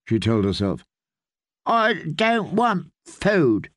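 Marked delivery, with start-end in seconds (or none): 1.7-3.7 put-on high, slow, reedy child whine; reedy, petulant. A narrator's reading. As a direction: sulk like a spoiled little lord. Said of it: "in a reedy, petulant voice".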